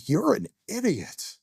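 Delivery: high inner voice telling himself off; scolding inner voice. Retold or imitated. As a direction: snap at yourself in a high pitched voice. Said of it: "and thinking".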